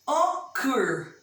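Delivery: "Occur" split o... CUR; stress on last syllable. Shown as a demonstration. Teacher pointing to the workbook example, stress on last syllable. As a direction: sound out "Occur" in two beats, strong on CUR. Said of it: "A última sílaba é forte".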